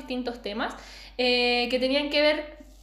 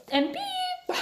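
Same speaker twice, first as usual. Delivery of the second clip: squeaky high beep over the word; mock censor beep; 3 dB quieter than usual. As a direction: bleep it out like a censor. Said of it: "no quiere que la gente sepa". (pitido de censura en broma)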